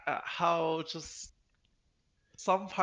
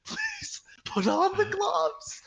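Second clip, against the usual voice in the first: high, strained, tearful mock begging; desperate plea; 5 dB louder, slower than usual. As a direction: drag it out as a hammed-up whimpering plea. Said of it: "he was so desperate looking".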